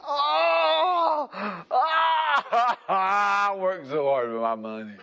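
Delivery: high, loud, hammed-up wail over lost money; mock wailing complaint. Preacher mimics a young'un crying over a bonehead deal, hammed-up wail. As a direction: wail like a young'un who just lost his savings. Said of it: "And you'll be thinking".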